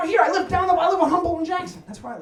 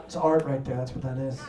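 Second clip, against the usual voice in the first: much lower and softer, satisfied art-lover murmur; admiring murmur; 13 semitones lower, 8 dB quieter than usual. Retold or imitated. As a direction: mumble it low as you admire your wall. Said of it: "Stare at them like".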